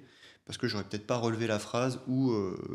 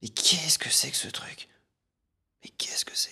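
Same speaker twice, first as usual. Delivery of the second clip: forceful voiceless whisper; baffled whisper; 5 semitones higher than usual. Acted. Puzzling over a strange gadget in the video, baffled whisper. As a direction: whisper it in disbelief.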